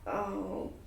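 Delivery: ordinary talk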